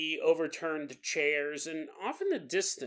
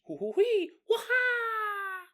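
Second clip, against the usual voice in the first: high whooping cackle; weird goblin noises; slower than usual. Retold or imitated. Goblin whoops at the scared visitors, high and wild. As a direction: whoop like a mad little creature. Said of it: "making these weird noises".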